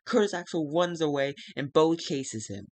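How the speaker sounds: ordinary talk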